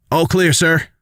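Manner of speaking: high-pitched voice calling a report; soldier reporting. Acted. Soldier reports to commander after battle, high called report. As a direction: call it out to a superior.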